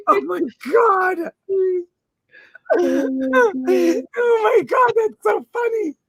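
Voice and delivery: high laughing oh my god. Real, not acted. Host laughing at chat. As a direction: crack up.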